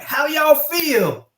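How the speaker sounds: high, loud, slow Southern call to the troops; rally call. Retold or imitated. Commander firing up his squadron, high loud slow call. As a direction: stretch out "How y'all feel?" high and loud. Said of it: "I would be like".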